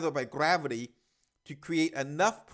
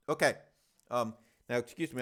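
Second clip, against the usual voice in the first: normal talking voice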